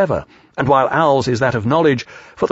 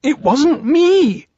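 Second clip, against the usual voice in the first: very high, drawn-out protest; high little creature digging in; insistent; 4 dB louder, much slower than usual. A narrator's reading. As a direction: insist in a piglet squeal. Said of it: "Piglet insisted".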